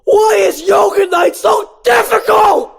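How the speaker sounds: high, loud, slow wailing shout; high slow hammy lament; Ken lamenting. Acted. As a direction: wail it to the ceiling.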